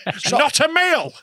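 high, loud, laughing protest; laughing shout. Real, not acted. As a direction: burst out laughing as you say it. Laughing protest about soup.

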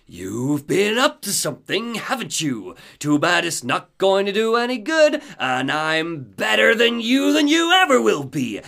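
a lightly voiced smug villain; Mister Scratch. Scratch taunting Alan, lightly voiced smug menace. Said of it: "we hear Mister Scratch's voice".

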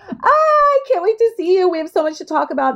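high, thrilled schoolgirl gush; excited squeal. Retold or imitated. Retelling friends reuniting, gushing with high excitement. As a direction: squeal it like a kid seeing her best friend.

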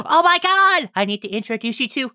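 high, loud gasp then gushing; excited small child. Acted. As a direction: squeal it like a thrilled kid. Excited small child introduces friends.

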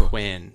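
"when" with a big puff of hw; fricative wh. Shown as a demonstration. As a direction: exaggerate the breathy wh at the start of "when". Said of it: "on those specific W H words".

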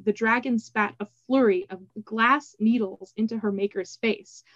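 usual speaking voice

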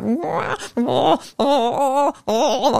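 high wailing baby cries, imitated; baby crying. Mum imitating her baby's wail to a friend. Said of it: "Rocky's just like".

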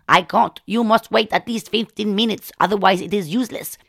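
calm, slow refusal in Indian English; firm Indian-accented healer. Retold or imitated. Healer refuses panicked patient, firm Indian accent.

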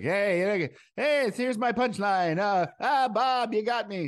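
high, loud, drawn-out punchline delivery; mock an over-the-top early stand-up act; stretched, high-pitched joke-teller voice; hokey comedian voice. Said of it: "I wrote jokes early in my comedy career that were like".

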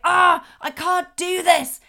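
loud, high, drawn-out exasperated whine; frustrated child. Acted. Child stuck on building blocks, frustrated outburst. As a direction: act a child fed up and giving up. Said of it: "if your child's been playing with blocks and they say".

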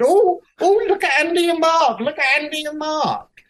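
high, loud sing-song jeering; mocking onlookers. Onlookers at the window teasing the kissing couple, shrill sing-song jeer. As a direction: squeal it like gleeful gossips at a window. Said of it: "everyone's hanging out the window going".

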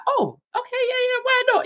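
very high squeaky agreement; hammed-up high pushover voice; mock eager self. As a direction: play your younger self caving in cheerfully. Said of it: "I'm like".

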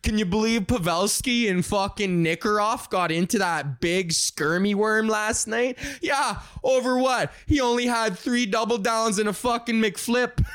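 mock hockey fans going back and forth; hockey talk. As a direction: argue in made-up hockey jargon. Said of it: "What hockey sounds like".

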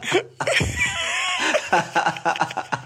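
shrieking real laugh; big laugh. Real, not acted. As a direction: laugh out hard at the snub. Laughing at the diss.

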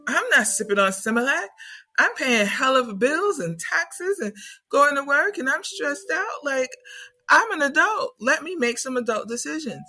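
put-on whiny defensive grown kid, stressed out; indignant young adult. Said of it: "Earth School is like".

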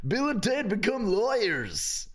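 filmtitel-aankondiging. Pretend. Imagined film title announced.

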